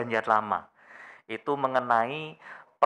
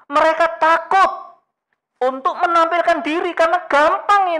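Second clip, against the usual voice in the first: high, loud impassioned preaching; raised, ringing pulpit voice; full-force preaching; 6 dB louder than usual. Acted. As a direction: preach it out with urgency. Passionate sermon climax.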